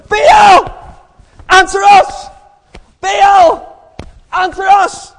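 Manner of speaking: very high, loud yells; frantic shouting. Acted. Prophets beating themselves to move Baal, shouting frantically. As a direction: yell it like frenzied worshippers. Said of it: "all shouting".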